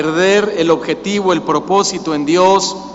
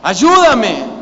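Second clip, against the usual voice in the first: raised, drawn-out plea to God; cry for help; much slower than usual. Acted. Preacher models crying to God for help, pleading call. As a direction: call out for help, voice swelling then falling. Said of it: "en lugar de la queja, decirle".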